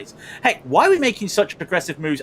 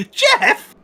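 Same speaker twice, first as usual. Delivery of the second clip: high, loud squawk of disbelief; incredulous; 6 dB louder than usual. Real, not acted. Commentator hearing his impression called Jeff, loud high incredulity. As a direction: throw the name back, shrill with disbelief.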